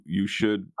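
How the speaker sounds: usual speaking voice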